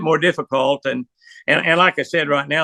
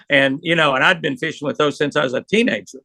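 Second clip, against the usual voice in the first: ordinary talk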